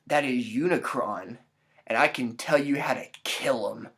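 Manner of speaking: a hint of a cryptic seer; mysterious lady. Mystery woman warning the dude, low and hushed. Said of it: "And she's like".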